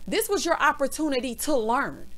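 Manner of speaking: ordinary delivery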